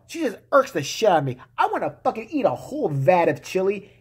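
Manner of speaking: usual speaking voice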